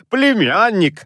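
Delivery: high, sharp rebuke; curt retort. A narrator's reading. Uncle cutting off his pleading nephew, curt retort. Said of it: "отрезал дядюшка".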